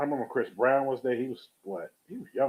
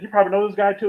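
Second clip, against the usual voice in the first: excited retold aside; 8 semitones higher, 7 dB louder, faster than usual. [name] quoted excitedly.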